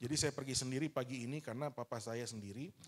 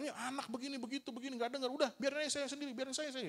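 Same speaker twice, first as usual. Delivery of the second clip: scolding father; 8 semitones higher than usual. Retold or imitated. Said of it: "Lalu kita dimarahin".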